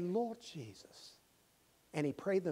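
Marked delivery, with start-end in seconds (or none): 0.0-1.1 soft slow prayer; hushed reverent prayer. Retold or imitated. Young man beginning his prayer, soft and slow. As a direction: breathe it reverently.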